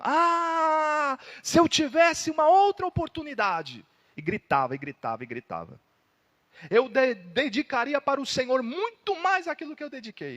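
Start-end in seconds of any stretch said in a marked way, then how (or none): 0.0-3.8 high, loud, anguished wail; desperate screaming. Acted. Damned man screaming for another chance. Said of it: "ele tava gritando". 6.7-10.4 desperate cry. Acted. Damned man crying out in despair. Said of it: "E gritava, e gritava, desesperado".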